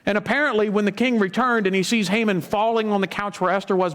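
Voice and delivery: usual speaking voice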